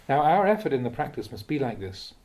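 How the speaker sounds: ordinary delivery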